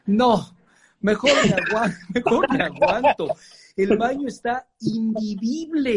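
can't hold back the laugh at the memory. Retold or imitated. Telling a friend about a filthy bathroom, laughing.

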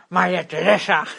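talking around a pen; words round a pen held in mouth; pen in teeth. Retold or imitated. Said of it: "me pongo el boli en la boca".

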